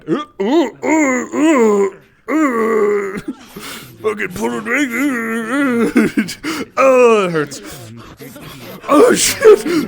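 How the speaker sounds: high, loud pained laughing groans; wheezing laughter that hurts; laughing through pain. Real, not acted.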